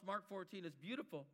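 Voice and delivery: ordinary talk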